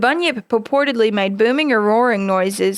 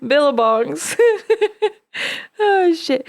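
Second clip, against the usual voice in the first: giggling through the words; real chuckling; 8 semitones higher than usual. Real, not acted. Host tickled by the word billabongs, chuckling as they speak. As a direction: try to say it and fail to hold back laughter. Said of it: "can't say it and not chuckle".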